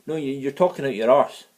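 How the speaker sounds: ordinary talk, nothing marked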